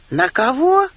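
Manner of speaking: high, loud startled question; surprised. A narrator's reading. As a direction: blurt out in surprise. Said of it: "удивлённо переспросил Вовчик".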